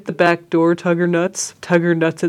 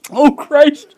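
laughing; 11 semitones higher, 7 dB louder than usual. Real, not acted. Breaking character, laughing.

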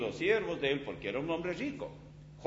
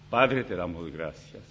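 pastoral prayer; 8 semitones lower than usual. Acted. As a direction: pray over the young people gently. Closing prayer.

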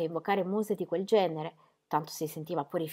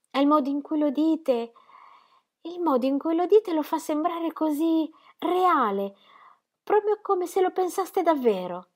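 much higher, breathless wonder rising; Ingeborg enraptured; 10 semitones higher, 3 dB louder than usual. Acted. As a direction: she can't believe how real it sounds. (Ingeborg incantata, voce molto più acuta)

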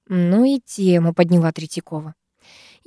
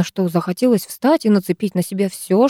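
ordinary delivery, nothing marked

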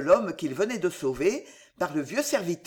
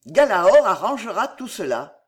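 louder, triumphant declaration; old soldier loudly declaring the horse will fix it; confident exclamation; 8 dB louder than usual. Acted. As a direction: announce it as the answer to everything. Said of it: "s'écria-t-il tout à coup".

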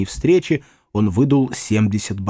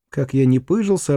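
plain reading voice

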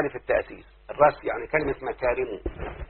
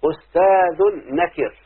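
slow, louder poetic recitation; reciting Shawqi's verse; 3 semitones higher, 3 dB louder, slower than usual. Shown as a demonstration. Teacher reciting Shawqi's poem to students, slow and declaiming. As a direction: declaim each word slowly. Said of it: "قال".

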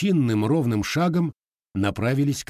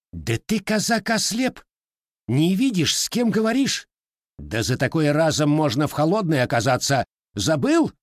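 angry outburst; 7 semitones higher, 4 dB louder than usual. Acted. Lieutenant rages at the Cossack.

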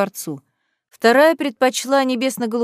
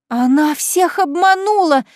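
high, loud, slow wail; wailing yell; 7 semitones higher, 4 dB louder, slower than usual. Acted. Beatrice exposing Kaitlyn's disguise, slow loud wail. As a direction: wail it to the crowd. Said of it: "завопила Беатрис".

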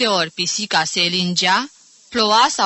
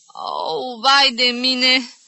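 long high moan swelling loud; yelp, then wail slowly in pain; pained cry; 6 semitones higher, 6 dB louder, much slower than usual. Acted. Aunt trying to move her sore shoulder, pained cry. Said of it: "încercând să se miște".

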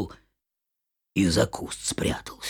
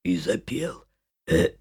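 ordinary delivery, nothing marked

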